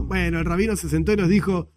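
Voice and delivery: ordinary talk, nothing marked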